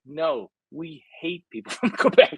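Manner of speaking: cabbie's line broken by the teller's own laugh; amused retelling of the taxi driver's scorn; laughing cabbie impression. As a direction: laugh through the cabbie's dismissal. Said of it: "He's like".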